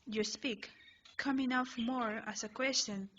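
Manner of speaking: plain reading voice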